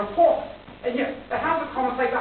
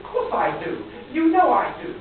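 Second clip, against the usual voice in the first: louder, higher burst of protest; emphatic insistence; 6 semitones higher, 5 dB louder than usual. Acted. Man assuring his doubting fiancée of his love, emphatically insistent. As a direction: insist on it, pitched up and forceful.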